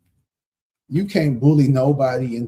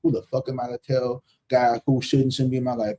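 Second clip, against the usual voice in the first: usual speaking voice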